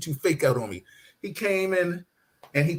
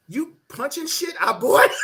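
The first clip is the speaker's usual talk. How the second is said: incredulous father; 12 semitones higher than usual. Retold or imitated. Angry father on phone.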